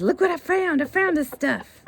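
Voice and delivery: high, loud rooster announcing a find; Roscoe. Acted. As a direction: show off proudly to the hens. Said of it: "calls the girls over and says".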